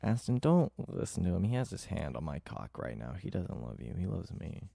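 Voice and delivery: ordinary talk, nothing marked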